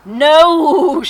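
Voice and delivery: a loud, high donkey bray on the word. Acted. The donkey brays her answer, loud and high. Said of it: "she brayed".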